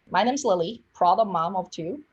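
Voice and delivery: normal talking voice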